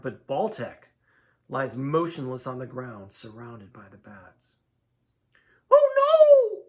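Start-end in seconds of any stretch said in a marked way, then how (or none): 5.7-6.6 very high, loud wail of alarm; piercing dismayed cry; Lydia crying out. A narrator's reading. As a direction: shriek it in a high female character voice. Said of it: "cries Lydia".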